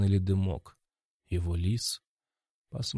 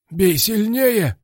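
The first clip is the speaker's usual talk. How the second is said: much higher, louder, slow shout; 11 semitones higher, 6 dB louder, much slower than usual. A narrator's reading. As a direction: yell it from the corner. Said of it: "крикнул Орлам".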